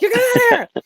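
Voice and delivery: high, loud mock scream; hammed-up, high-pitched yell; imitated scream. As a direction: scream it like an over-intense dying soap actor. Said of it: "he's screaming. He's screaming all his lines".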